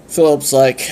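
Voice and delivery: usual speaking voice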